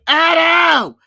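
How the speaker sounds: loud, high drawn-out chant shout; imitated crowd scream. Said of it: "People screaming".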